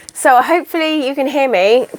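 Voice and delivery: ordinary talk, nothing marked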